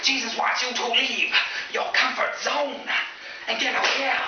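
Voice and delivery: high, slow, forceful exhortation; hammed-up revivalist shouting; preacher. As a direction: holler it phrase by phrase from the pulpit. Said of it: "He's like".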